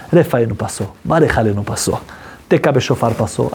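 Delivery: usual speaking voice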